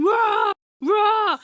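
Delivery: loud, high mock roar; full-voiced retold monster roar; imitated screaming roar. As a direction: roar like someone jumping out to scare a child. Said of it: "he carries on screaming".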